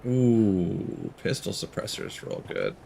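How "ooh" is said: ordinary talk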